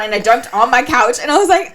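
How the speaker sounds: usual speaking voice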